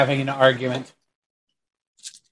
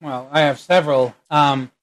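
ordinary talk

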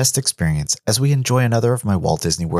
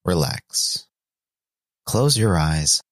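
right in your ear; 5 semitones lower than usual. Acted. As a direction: ease the listener in gently.

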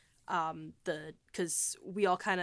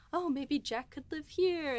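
high, slow, dreamy fan delight; excited fan wonder; 8 semitones higher than usual. Retold or imitated. Honeymooner recalling walking round York, excitedly imagining the characters. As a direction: imitate yourself gushing, pitched well up.

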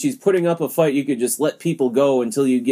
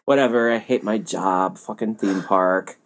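bored employee; 4 semitones lower than usual. Retold or imitated. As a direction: act out a jaded worker who doesn't care. Mocking bored theme park employee.

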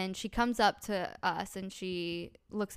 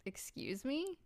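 softer, high rising disbelief; taken aback; 6 semitones higher, 7 dB quieter, slower than usual. Retold or imitated. Bride answering a stranger's rude order, quietly incredulous. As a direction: re-enact a quietly affronted question.

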